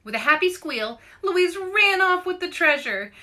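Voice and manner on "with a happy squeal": plain reading voice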